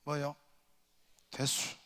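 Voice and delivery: soft, terse Chungcheong accent; clipped Chungcheong reply; lightly voiced Chungcheong dialect. Shown as a demonstration. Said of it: "충청도".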